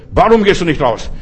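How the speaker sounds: lauter, fordernder Befehl. Retold or imitated. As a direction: den Dämon laut anherrschen. Retold confrontation with the demon. (laut und fordernd gefragt)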